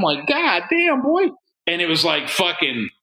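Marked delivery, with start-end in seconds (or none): 0.3-1.3 awed exclamation. Retold or imitated. Guy reacting to a giant dildo, high amazed exclaim. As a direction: pitch jumps way up in amazement.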